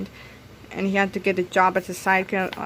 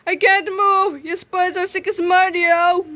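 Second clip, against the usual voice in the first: squeaky cry of the stuck hero; high hero voice; 7 semitones higher, slower than usual. Acted. Hero buried in mashed potatoes, high panicked whine.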